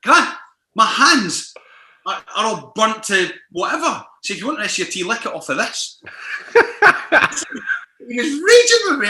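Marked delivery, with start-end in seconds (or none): 0.0-6.0 higher and louder, mock indignant; telling off a colleague over a tea, raised and high; exasperated retort. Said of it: "I'm like". 6.1-8.0 breathy high cackle; high laugh. Real, not acted. Laughing at her own story.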